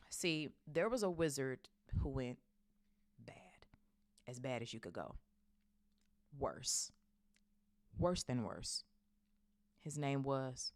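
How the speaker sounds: halting, reluctant, long pauses; hesitant dread. A narrator's reading. Hagrid struggling to name the bad wizard, hesitant. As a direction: force each piece out haltingly.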